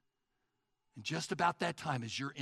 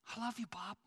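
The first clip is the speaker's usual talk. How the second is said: tender; 3 semitones higher, 7 dB quieter, faster than usual. Acted. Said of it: "it says".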